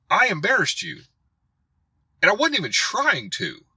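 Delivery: worked-up rebuke with punched key words; exasperated rant. Real, not acted. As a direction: scold him directly, pitch climbing point by point. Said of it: "that's my rant".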